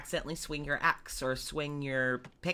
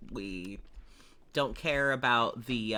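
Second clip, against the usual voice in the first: ordinary talk, nothing marked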